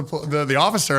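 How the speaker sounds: ordinary delivery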